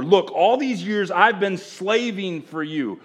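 a hint of a resentful son; older brother's shame. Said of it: "hear the shame in the older brother's voice".

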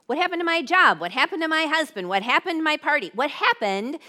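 high, fast, loud rush of questions; mock panic. Acting out people whose plans collapse, mock panic. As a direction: fire off bewildered questions in mock alarm. Acting out life's sudden losses.

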